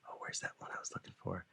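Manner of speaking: quick whispered aside, fast; teacher whispering while looking for the song. Real, not acted. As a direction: whisper to yourself while searching. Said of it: "whispered aside searching".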